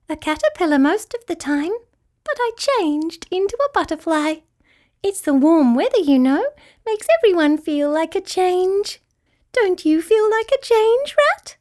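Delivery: high, dainty creature voice; high-pitched twinkly cheer; tinkly butterfly. A narrator's reading. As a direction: chatter happily as a tiny butterfly. Tiny Butterfly explains herself.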